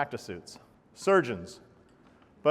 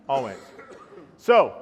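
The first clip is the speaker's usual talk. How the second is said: ordinary talk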